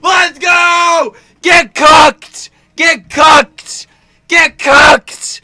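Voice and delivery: very high, loud victory shouts; triumphant screaming. Real, not acted. Gamer celebrating a hit on his rival, triumphant screaming. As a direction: scream it out, gloating.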